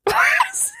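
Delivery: high shouted "what"; shocked. Real, not acted. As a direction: yelp it in disbelief. Shocked at job title.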